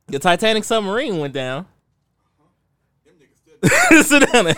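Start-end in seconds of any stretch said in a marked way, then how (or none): 3.6-4.5 laughing while telling off; giggling through the words. Real, not acted. Laughing at own joke.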